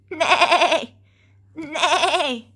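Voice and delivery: loud neighing horse call. Retold or imitated. Showing children what the horse says, loud neigh. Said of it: "A horse says".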